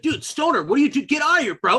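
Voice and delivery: high-pitched jock outburst; aggressive bully. Acted. Kyle shoving the stoner away, aggressive.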